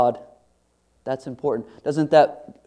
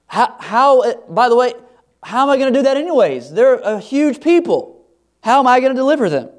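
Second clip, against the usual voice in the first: higher, louder and faster, rising to a flustered squeak; confused protest; 8 semitones higher, 6 dB louder than usual. Acted. Moses protesting to God, confused and building to flustered alarm. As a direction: play Moses, climbing into flustered disbelief.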